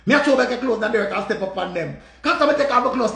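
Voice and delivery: ordinary talk, nothing marked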